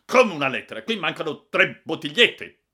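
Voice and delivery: loud, high, incredulous anger; angry shouted disbelief; outraged retort. A narrator's reading. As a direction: challenge the excuse at full volume. Owner furious about missing samples.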